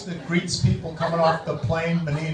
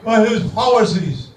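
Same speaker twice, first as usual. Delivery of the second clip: Menino impression; 4 semitones higher than usual. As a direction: stumble through a speech like Mayor Menino. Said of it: "my tribute to the outgoing mayor, Tom Menino".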